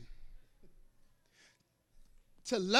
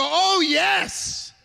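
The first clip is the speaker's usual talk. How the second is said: high, loud ecstatic praise; loud happy shout of praise; joyful oh yes; 8 semitones higher, 7 dB louder than usual. Retold or imitated. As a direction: throw your head back and exult. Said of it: "you throw your hands up and your head back and you go".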